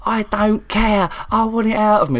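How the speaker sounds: high, slow, loud mock demand; high hammed-up patient shouting. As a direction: insist like a stubborn patient.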